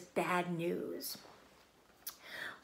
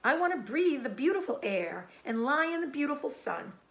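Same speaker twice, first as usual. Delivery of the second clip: high, pleading wail; 8 semitones higher, 3 dB louder than usual. Acted. Wilbur wailing about life, high. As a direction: cry out your longing.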